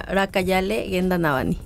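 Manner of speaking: "Rakayale Genda Navani" pronounced in Zapotec; Zapotec pronunciation. Shown as a demonstration. Midwife shows the host how it is pronounced, "Rakayale Genda Navani" in Zapotec. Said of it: "¿cómo se pronuncia?".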